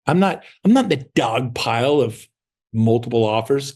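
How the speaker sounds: normal talking voice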